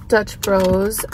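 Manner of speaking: normal talking voice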